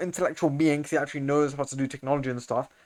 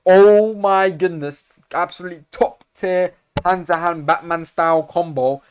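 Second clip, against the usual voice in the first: drawn-out, louder gasp of awe; slow, much louder fanboy awe at the fight; hyped amazement; 3 semitones higher, 7 dB louder, slower than usual. Real, not acted. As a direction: stretch it out, blown away. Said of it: "hyped reaction to fight".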